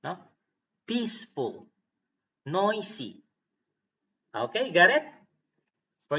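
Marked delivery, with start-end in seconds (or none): 0.9-3.2 PEACE and NOI lifted high, endings falling; stress on first syllable. Shown as a demonstration. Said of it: "put a stress on it".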